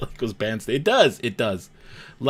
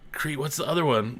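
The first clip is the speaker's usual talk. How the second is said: ordinary talk, nothing marked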